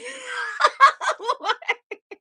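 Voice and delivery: real high burst of laughter; delighted high laughing fit. Real, not acted. Artist delighted by chat's comment, real high laughter.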